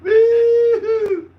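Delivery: loud, high whooping cheer; excited whoop. Real, not acted. Cheering at the stubby holder on stream, loud high whoop. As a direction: let out a big party whoop.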